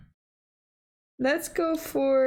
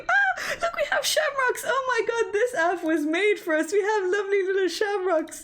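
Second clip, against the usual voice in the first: high, bright delight over a cute detail; delighted squeal; 7 semitones higher than usual. Real, not acted. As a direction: gush with sudden delight. Delighted at the app's shamrocks.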